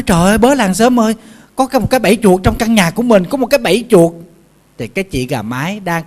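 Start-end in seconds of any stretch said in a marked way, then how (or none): 0.0-4.1 high, loud and rushed alarm to the neighbours; frantic mouse shouting for help; mouse crying for help. Acted. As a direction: call the whole village in a panic. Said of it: "nó la lên".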